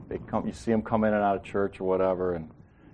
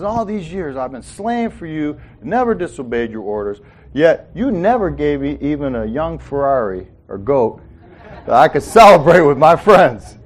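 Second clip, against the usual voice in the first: ticked off; 3 semitones higher, 7 dB louder than usual. Retold or imitated. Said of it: "he's ticked".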